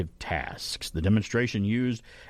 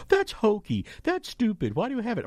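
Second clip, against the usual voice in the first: mocking critics; 10 semitones higher than usual. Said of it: "They're like".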